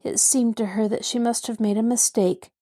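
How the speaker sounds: ordinary delivery, nothing marked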